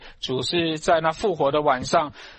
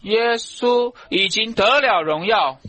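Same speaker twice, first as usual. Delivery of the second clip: high, slow, forceful declaration; triumphant proclamation; 6 semitones higher, 4 dB louder, slower than usual. Real, not acted. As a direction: proclaim it high and drawn out to the congregation. Preacher proclaims after hallelujah.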